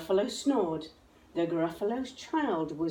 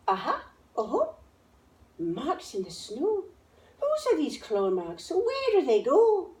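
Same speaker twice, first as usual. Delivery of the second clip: put-on higher, eager child voice rising at the end; little monster child; 8 semitones higher than usual. A narrator's reading. Gruffalo's child tracking claw marks, higher and eager. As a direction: follow the claw marks boldly.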